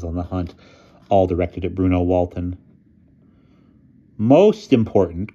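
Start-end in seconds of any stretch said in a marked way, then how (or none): 4.2-5.3 loud, slow, raised call; crying out. A narrator's reading. Boy cuts through the babble at dinner, crying out. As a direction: call out over a noisy room. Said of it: "cried Bruno over the din".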